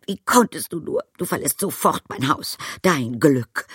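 high, loud, indignant scolding; shrieky upset fury; tearful outrage. A narrator's reading. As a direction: throw him out, near tears. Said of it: "Sie war den Tränen nahe".

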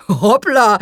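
a sudden oops as the tray wobbles; startled exclamation. Acted. Father nearly knocked over by the twins, startled oops. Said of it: "rief ihr Vater".

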